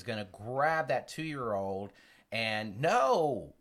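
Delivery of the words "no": sharp loud warning shout. Retold or imitated. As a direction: yell it to stop a child. Father grabbing child from stove.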